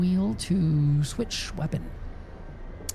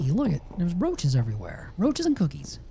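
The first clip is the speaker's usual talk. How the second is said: usual speaking voice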